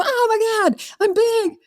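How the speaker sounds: very high squealed realisation; overwhelmed gasp. Retold or imitated. Client realising they are big, squealing. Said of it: "they go".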